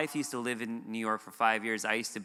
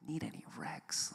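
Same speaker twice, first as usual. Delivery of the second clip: whispered, puzzled repeat; baffled echo; 2 semitones higher, 12 dB quieter than usual. Retold or imitated. The preacher repeating the worker's odd words to himself, baffled whisper. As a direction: mouth the phrase back in disbelief. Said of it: "I was like".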